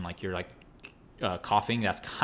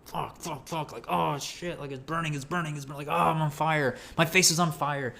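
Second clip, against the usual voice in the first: imitating his own yells of pain, pitched up and held back; retold cries of pain; 6 semitones higher than usual. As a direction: act out burning pain, half-voiced. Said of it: "I started screaming in pain".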